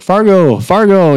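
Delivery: high, loud call across the club. Retold or imitated. Club members greeting him, calling out loud. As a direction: hail him by his nickname.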